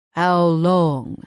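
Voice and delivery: the giant stranger asks coldly; cold question. A narrator's reading. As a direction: clip it out with no warmth. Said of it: "he asks coldly".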